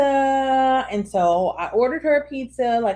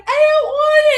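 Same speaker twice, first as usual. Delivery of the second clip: put-on high-pitched, loud child refusal; mock a picky kid's protest; imitated whining kid; 8 dB louder than usual. Acts out daughter refusing the pizza.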